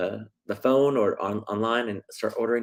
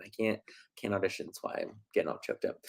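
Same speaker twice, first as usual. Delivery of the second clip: mock choked up; 2 semitones lower, 10 dB quieter than usual. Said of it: "got a little choked up there".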